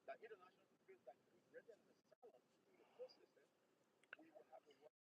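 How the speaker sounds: faint, distant off-mic voice; speaking far from the phone; phone held away. Real, not acted. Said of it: "Come back, come back".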